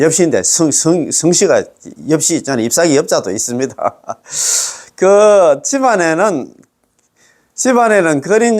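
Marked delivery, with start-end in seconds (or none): 3.8-4.7 brief low chuckle; amused little laugh. Real, not acted. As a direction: laugh softly in passing. Amused aside about the surname.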